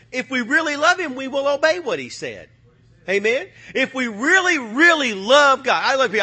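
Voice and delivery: high, loud, rising preacher's fervor; fiery preaching. Acted. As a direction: preach it with full force. Preacher building to a peak.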